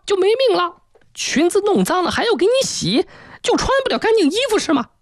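very high, shrill tirade; furious scolding. Acted. Mother tearing into daughter for falling and dirtying clothes, shrill scolding. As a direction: act out a relentless nagging mother in a piercing voice. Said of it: "又是一阵劈头盖脸的痛骂".

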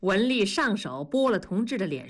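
ordinary delivery, nothing marked

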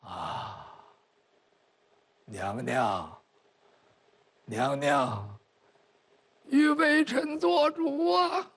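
low, faint, slow dying voice; a weak old minister pleading; feeble old man. Acted. Feeble old minister begs empress. (虚弱老臣低声哀求)

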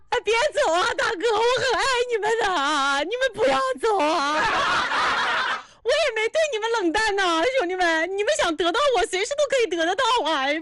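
high-pitched fake sobbing plea; mock pleading wail. Streamer begging the big brothers not to leave, mock wail. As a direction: beg in a hammed-up wail, half laughing.

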